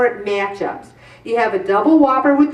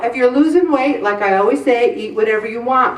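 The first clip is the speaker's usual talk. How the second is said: ordinary delivery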